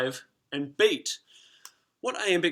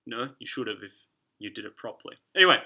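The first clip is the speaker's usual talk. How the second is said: ordinary talk, nothing marked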